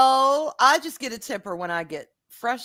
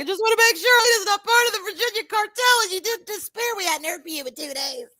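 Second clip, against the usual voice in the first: worried mom voice; 9 semitones higher, 4 dB louder than usual. Retold or imitated. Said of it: "She's like".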